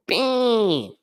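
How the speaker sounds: high sung "bing" like a phone notification; notification ding. Retold or imitated. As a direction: chime it out high like an alert. Imitating notification sound.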